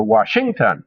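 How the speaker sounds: WASHINGTON stressed on the middle syllable, SHING; misplaced middle stress. Shown as a demonstration. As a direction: lean hard on "shing" in "Washington". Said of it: "We don't say Washington".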